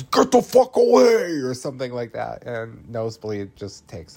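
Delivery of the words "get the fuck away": much higher, louder snarling yell; wolfish yell. Retold or imitated. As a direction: scare her off with a shout. Ellie turning wolf-like.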